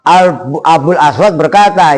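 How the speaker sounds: ordinary talk, nothing marked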